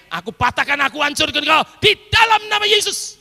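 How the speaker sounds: loud, high rebuke of the spirit of death; commanding prayer. Retold or imitated. Preacher praying over the phone for a dying girl, loud and commanding. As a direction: command sickness out with full force.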